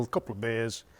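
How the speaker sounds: ordinary talk, nothing marked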